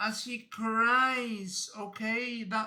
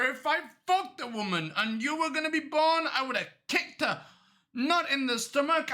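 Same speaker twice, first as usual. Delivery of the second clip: raised, scornful comic rant; angry tirade. Acted. Cop disowning the killer as son, angry rant. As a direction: tear into him in mock outrage.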